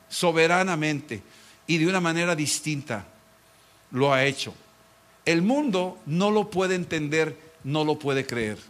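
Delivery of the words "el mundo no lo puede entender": ordinary talk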